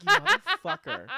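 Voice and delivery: high loud laughing jab; laughing insult. Retold or imitated. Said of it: "I'm like".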